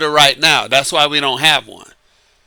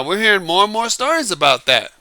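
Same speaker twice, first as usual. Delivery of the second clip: ordinary talk, nothing marked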